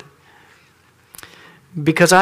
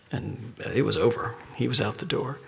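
usual speaking voice

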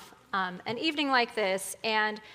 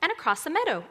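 put-on high, bouncy little girl voice; sing-song cheerful storybook child; 7 semitones higher than usual. A narrator's reading. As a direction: answer brightly and innocently, lilting each phrase. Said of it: "answered Little Red cheerfully".

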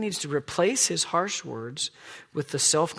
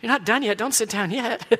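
high, louder mock protest; hammed-up plea for more, higher and louder; playful demand; 8 semitones higher, 6 dB louder than usual. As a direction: call out in mock insistence. Playfully demanding more praise from family.

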